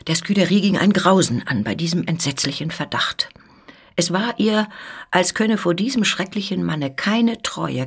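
ordinary delivery, nothing marked

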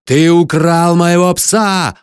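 high, loud, drawn-out accusation; beside-himself furious shout; frenzied yelling. A narrator's reading. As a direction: scream the accusation slowly. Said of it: "орал я".

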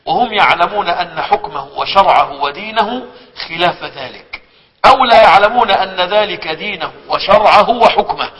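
slow, measured classical reading; solemn reading of a classical scholar; formal recitation. Acted. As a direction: read the old text with weight. Reads classical text aloud.

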